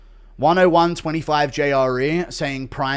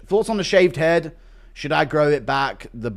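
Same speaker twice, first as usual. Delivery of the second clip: usual speaking voice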